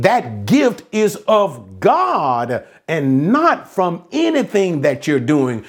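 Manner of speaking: ordinary talk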